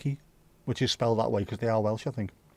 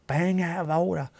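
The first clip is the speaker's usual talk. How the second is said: higher-pitched, exaggerated repeat; mock outrage; 4 semitones higher than usual. Complaining that Wales has too many beautiful places, mock outraged. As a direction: ham up the indignation in a put-on voice.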